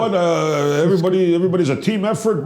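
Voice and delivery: high-pitched mock athlete interview; scripted player soundbite. Host mocking players who know they're on NBC, high scripted patter. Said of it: "It's like a written script".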